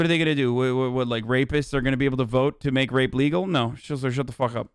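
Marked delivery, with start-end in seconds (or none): none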